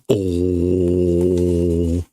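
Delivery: ordinary delivery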